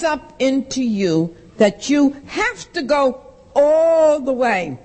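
slow, emphatic, landing on all the way; slow, deliberate warning. Retold or imitated. AA woman firmly explaining compulsion to Liz. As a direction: spell it out carefully. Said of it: "She said".

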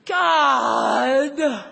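loud, drawn-out exasperated cry; mock disgusted outburst; judgmental noise. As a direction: blurt it loud in exaggerated judgment. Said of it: "it makes those noises like".

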